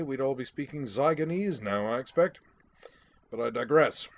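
brisk, amused military gent; a hint of a bluff English brigadier; hearty British Brigadier. Brigadier voiced.

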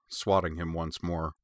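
ordinary delivery, nothing marked

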